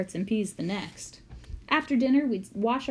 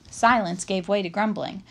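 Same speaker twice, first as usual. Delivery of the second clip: usual speaking voice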